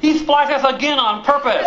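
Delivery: high whiny complaint; whiny kid tattling. Acted. Ducklings tattle again.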